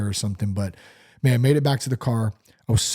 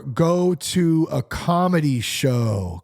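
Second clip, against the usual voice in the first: drawn out announcement; slow deliberate; 3 semitones higher, much slower than usual. Acted. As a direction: spell out the tip slowly.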